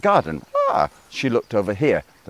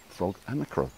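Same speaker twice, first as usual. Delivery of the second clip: plain reading voice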